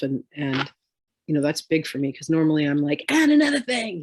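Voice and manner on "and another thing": high, louder snap of a last word; argumentative. Retold or imitated. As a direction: jump in to win the argument. Retold argumentative self, last word.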